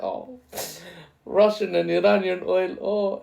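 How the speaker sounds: high, tempted mock sigh at cheap oil; a European voice drawn to Russian oil. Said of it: "their gaze might wander to the east and be like".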